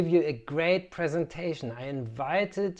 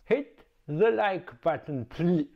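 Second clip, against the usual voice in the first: cartoon voice; 2 semitones higher than usual. Inserted like-button plea.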